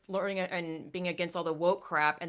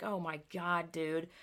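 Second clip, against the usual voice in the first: muttered disbelief; 3 semitones lower, 8 dB quieter, slower than usual. Retold or imitated. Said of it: "I'm like".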